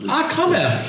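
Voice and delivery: calling out. Acted. Spots Kramer and calls out.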